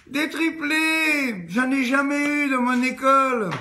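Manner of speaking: teacher marvelling at triplets in her school; gushing teacher. Acted. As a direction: coo like an amazed schoolmistress. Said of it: "elle dit".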